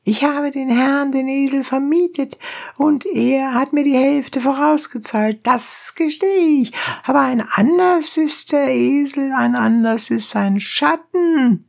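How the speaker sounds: very high, protesting donkey driver; high-pitched indignant peasant voice; crying donkey driver. A narrator's reading. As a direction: plead your case in a high cry. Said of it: "schrie der Eseltreiber".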